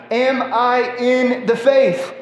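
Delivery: loud, slow pleading questions; preaching at full force. Acted. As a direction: press each question on the congregation.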